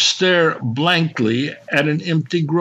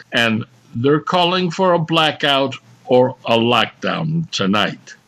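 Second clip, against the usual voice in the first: ordinary delivery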